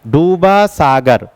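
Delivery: slow and drawn out, each word spaced; slow, deliberate memory-trick recital; slow mnemonic recital. Shown as a demonstration. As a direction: recite it slowly so it sticks. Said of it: "नाम कैसे याद रखोगे?".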